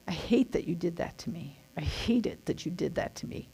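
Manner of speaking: whispered, low resentful words; bitter whisper. Shown as a demonstration. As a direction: hiss out the grudge under your breath. Voicing the angry inner thought.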